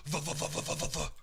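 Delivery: rhythmic vuh vuh imitating a pothole jolt; pothole bump noise. Shown as a demonstration. Friend imitating a truck hitting a pothole, choppy vuh sounds. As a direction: bounce out the bumps of a pothole. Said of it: "a pothole goes like this".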